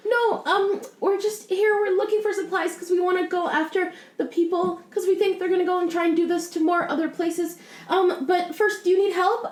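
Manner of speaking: calm her fears kindly; reassuring cleric. Acted. Player voices her cleric.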